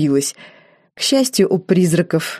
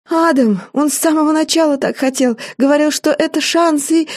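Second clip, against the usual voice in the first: high, rushed and scared; frightened confession; 9 semitones higher than usual. A narrator's reading. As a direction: blurt it out in fear.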